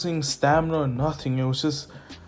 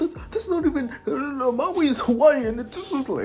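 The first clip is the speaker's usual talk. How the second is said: high, whiny mocking of a commenter; mock angry commenter; 11 semitones higher than usual. Narrator mocking a commenter angry about Maui, high and whiny. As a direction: parody an upset fan complaining. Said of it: "get heat in the comments saying".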